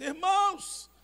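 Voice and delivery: loud preaching. Retold or imitated. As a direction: call it out to the church. Retells preaching to the congregation.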